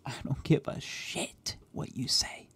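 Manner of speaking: menacing whisper. Acted. Threatening the wiped squad, menacing whisper. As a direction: whisper the threat close to the mic.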